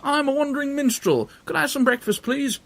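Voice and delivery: put-on bright boyish high voice; high Jack voice. Jack asks for breakfast.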